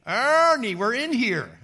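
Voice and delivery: loud, high, drawn-out holler; calling out. Acted. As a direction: call out to someone in another room. Calling out to Ernie.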